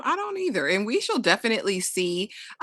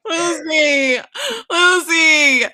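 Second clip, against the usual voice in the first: hammed-up cry of a jilted lover; mock anguished wail; 5 semitones higher, 4 dB louder, much slower than usual. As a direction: wail the name, drawn out and raised. Acted breakup, wailing the name.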